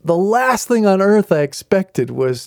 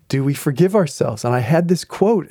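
normal talking voice

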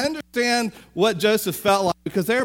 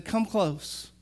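softer, gentle come-close; gentle invitation; 6 dB quieter than usual. Retold or imitated. Joseph softly beckons his brothers. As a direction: beckon quietly.

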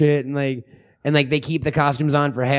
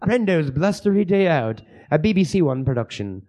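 mock BBC title announcement in British; slaughtered British accent. Host announces the film as a BBC production, mock British. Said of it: "me slaughtering a fucking, uh, a British accent".

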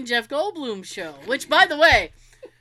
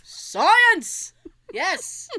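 exclaiming; 6 semitones higher, 3 dB louder than usual. Pretend. Echo shout.

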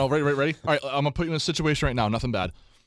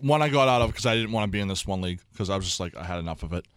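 normal talking voice

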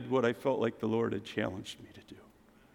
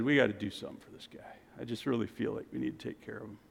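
hushed aside; 4 semitones lower, 11 dB quieter, much faster than usual. Retold or imitated. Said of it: "I looked at Barb and I said".

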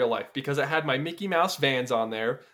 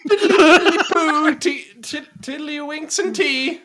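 high, slow laughing toff voice; silly English gentleman; 10 semitones higher, 7 dB louder, slower than usual. Pretend. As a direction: ham up a jolly old Brit. Joking parody of Mystic Manor.

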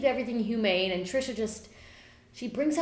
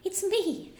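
high, slow tiny fairy voice; squeaky little fairy greeting; 10 semitones higher than usual. Acted. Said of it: "a fairy will pop out and say".

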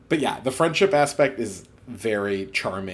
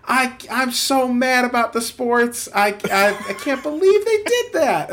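much higher, louder, strained voice of a clueless man acting mad about sports; a character pretending to be furious about sports, in a much higher and louder voice; clueless fake sports fan; 12 semitones higher, 5 dB louder than usual. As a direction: whine it up high like a guy pretending to care about sports. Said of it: "And he's like".

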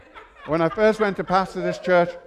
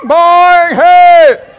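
shouted call, higher and louder; shouting; 11 semitones higher, 16 dB louder than usual. Acted. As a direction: bark it out. Shouted exclamation.

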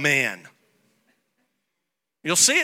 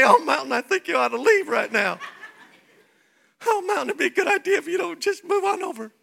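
timid prayer; 10 semitones higher, faster than usual. Retold or imitated. Pastor mocking a timid believer, meek and high. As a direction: squeak it out timidly. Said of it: "don't say".

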